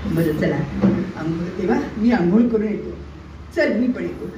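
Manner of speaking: usual speaking voice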